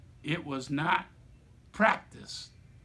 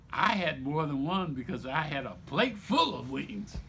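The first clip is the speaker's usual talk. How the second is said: ordinary talk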